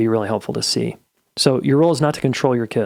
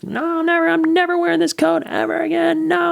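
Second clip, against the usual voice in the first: high-pitched mock wail of a furious kid; raging tantrum. Kid refusing a coat from mom in the parking lot, mock raging. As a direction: imitate a kid's tearful, raging protest, far higher. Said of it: "and he was raging".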